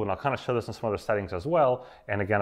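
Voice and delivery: usual speaking voice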